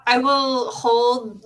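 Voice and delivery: ordinary talk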